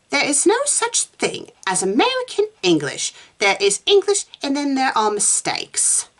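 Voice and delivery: high, certain pronouncement; calm authority. A narrator's reading. Susan correcting Meg on English, calm authority. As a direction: declare it high, as someone who knows they're right. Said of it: "with the calm authority of someone".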